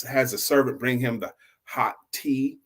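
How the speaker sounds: ordinary delivery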